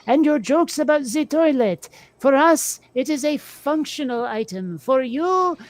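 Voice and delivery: a hint of a haughty French accent; lightly mock French taunter. Joking to a friend about Americans, light mock French accent. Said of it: "That's the French taunter".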